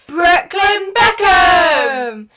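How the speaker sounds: drawn-out, loud announcer shout; mock grand reveal. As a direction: belt the name like a game-show reveal. Big reveal.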